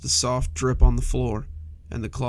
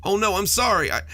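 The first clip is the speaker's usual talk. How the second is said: higher, hurried frightened apology; quailing in terror; 8 semitones higher, 3 dB louder than usual. A narrator's reading. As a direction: shrink back, scared and sorry. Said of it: "The monster quailed in terror".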